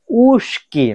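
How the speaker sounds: উস্কি with a sh sound before the k; pronunciation demo. Shown as a demonstration. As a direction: model উস্কি for learners, long first vowel and hushing sibilant. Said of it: "but উচ্চারণটা হয়েছে উস্কি". (উস্কি-র উচ্চারণ শ ধ্বনি দিয়ে)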